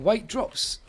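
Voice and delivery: normal talking voice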